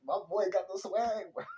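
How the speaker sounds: high-pitched, excited cheering on; proud hype. Real, not acted. Proud hyping of favourite character.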